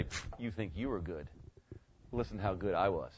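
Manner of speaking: low, dismissive mock boast; one-upping brag. Preacher outdoing Tony in heaven, bragging. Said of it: "are bragging back and forth trying to outdo each other".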